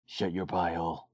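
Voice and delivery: low, quiet threat; growing menace kept low and soft; Gooseman imitation. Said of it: "do my Gooseman imitation".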